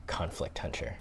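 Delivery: whispered series title; whispering. Acted. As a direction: breathe the title like a trailer. Repeats series name whispered.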